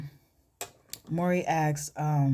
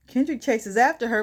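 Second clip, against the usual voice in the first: plain reading voice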